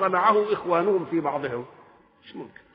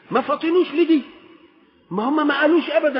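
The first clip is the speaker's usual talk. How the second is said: emphatic preaching; 7 semitones higher, 5 dB louder, faster than usual. Real, not acted. Preacher driving his point home.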